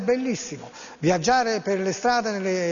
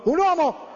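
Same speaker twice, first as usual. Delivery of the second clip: UN UOMO pitched high; "un uomo" lifted high for comic effect; mock-grand emphasis; 8 semitones higher than usual. Mock grand call for a leader.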